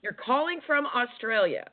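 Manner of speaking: high, disbelieving echo of the caller; real surprise at a far-off caller; surprised. Real, not acted. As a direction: jump up in pitch, amazed. Host surprised caller is abroad.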